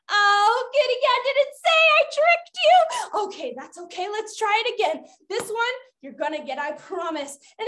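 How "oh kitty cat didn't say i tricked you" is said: high, teasing game-leader call; playful gotcha. Pretend. Game leader to children she tricked, playful gotcha. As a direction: tease the players for falling for it. Gloats over tricking the players.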